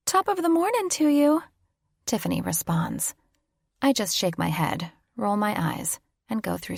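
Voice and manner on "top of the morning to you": high, hammed-up Irish lilt; mock Irish farewell. Tiffany teasing her friend goodbye at the airport in a mock Irish lilt. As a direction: toss it off as a jokey stage-Irish goodbye.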